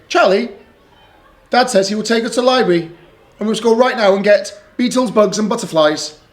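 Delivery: put-on chirpy pleading child voice; a little girl begging her brother; little sister Lola.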